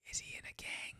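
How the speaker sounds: imitating grandma's hushed whisper. Grandmother asking his wife's mom about him, whispered. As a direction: breathe it secretly, no voice. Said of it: "her grandmother whispered to her mom".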